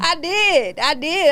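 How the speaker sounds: high, loud insistence; insist, loud and high; emphatic. Real, not acted. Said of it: "emphatic insistence".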